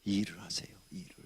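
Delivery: hushed plea to get to work; urgent whisper. Acted. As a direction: lean in and whisper the command. Pastor urging congregation.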